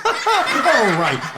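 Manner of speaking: ordinary delivery, nothing marked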